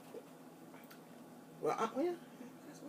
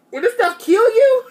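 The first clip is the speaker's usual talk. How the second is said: alarmed cry; 10 semitones higher, 8 dB louder than usual. Real, not acted. Can't stop burping.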